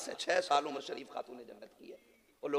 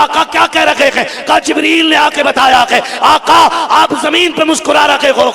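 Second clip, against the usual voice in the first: high, loud declamatory shouting; full-force preaching; 12 semitones higher, 23 dB louder than usual. Acted. Preacher telling the crowd of Khadija's sacrifice, impassioned shouting. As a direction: belt out the sacred story to the crowd.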